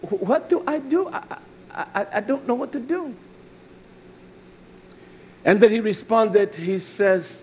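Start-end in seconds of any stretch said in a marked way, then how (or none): 0.0-3.1 higher, soft stammer; acted bewilderment, halting and quiet; befuddled. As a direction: imitate someone stuck and stammering for words.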